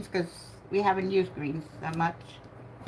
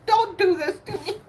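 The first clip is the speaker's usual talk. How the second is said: high, whiny begging to a broken car; pleading with the car; 9 semitones higher than usual. Retold or imitated. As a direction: beg the car to start, pitch way up. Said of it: "I would always say that".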